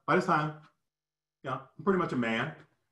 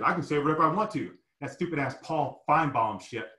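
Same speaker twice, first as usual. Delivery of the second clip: ordinary talk, nothing marked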